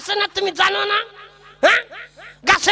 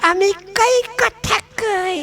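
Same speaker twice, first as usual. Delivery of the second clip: sulky protest; much slower than usual. Acted. Groom protests, drawn out.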